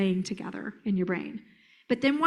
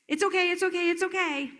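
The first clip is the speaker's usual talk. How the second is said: high, quick, repeated reassurance; urgent cadence; 7 semitones higher, faster than usual. Shown as a demonstration. Trainer mirroring an anxious child's cadence, urgent and high. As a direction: rattle it out in a high, steady rhythm. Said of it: "he's giving you a cadence".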